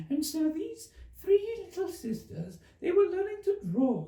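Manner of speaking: Dormouse voice. Acted.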